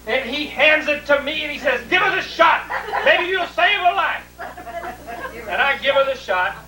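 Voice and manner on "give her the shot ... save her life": father's excited order. Retold or imitated. Father voiced in retold story.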